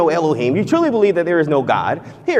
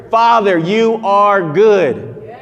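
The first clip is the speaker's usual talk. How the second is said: slow sincere prayer; 3 semitones higher, much slower than usual. Shown as a demonstration. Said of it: "Listen to these words".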